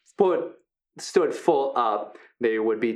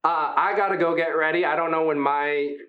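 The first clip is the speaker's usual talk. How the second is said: a lightly higher, friendly voice for a huge gentle creature; friendly hulking Brutus; 3 semitones higher than usual. Acted. Big gentle wrestler Brutus welcoming the new fighters, upbeat. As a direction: play the big guy as sweet and encouraging.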